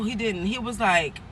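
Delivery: ordinary talk, nothing marked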